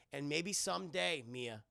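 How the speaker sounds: ordinary talk, nothing marked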